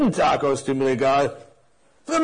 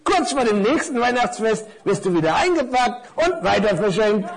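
high-pitched little explainer; knowing necktie; 6 semitones higher, 3 dB louder than usual. Acted. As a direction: explain it in a raised character voice. (belehrende Krawatte mit hoher Stimme)

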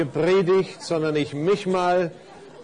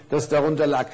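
ordinary delivery

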